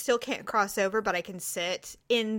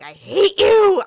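louder, slow seething line; mock hateful mutter at the guard; 6 semitones higher, 9 dB louder, slower than usual. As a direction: let the spite out slowly. Inner reaction to guard.

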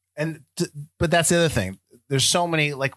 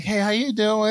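Pop greeting; 10 semitones higher than usual. Retold or imitated. Pop calling to kids going into the party, high mock greeting. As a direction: call it out like a cranky old man being friendly. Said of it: "He's like, and he's like".

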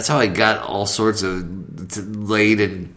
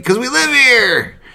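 high shouted excuse; 7 dB louder, slower than usual. Retold or imitated. Acted scene, drunk reply.

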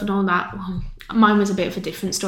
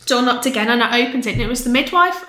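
ordinary talk

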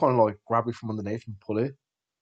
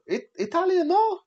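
high, lilting mock Italian accent asking; Italian man asking; 3 dB louder than usual. Cyprus stranger asks him in mock Italian accent. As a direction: put on a singsong Italian asking if he is.

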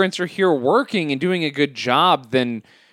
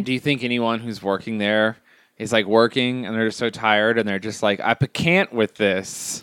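telling a dad joke; 8 semitones lower than usual. Acted. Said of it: "Every time I tell a dad joke".

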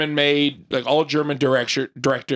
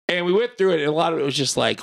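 normal talking voice

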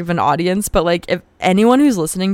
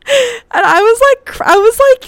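high, loud squeal through laughter; laughing too hard to talk; helpless laughter; 8 dB louder than usual. Real, not acted. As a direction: squeak the words out while cracking up. Still cracking up while telling.

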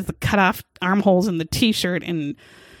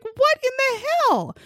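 mock outraged wife. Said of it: "She's like".

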